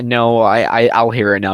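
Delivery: ordinary talk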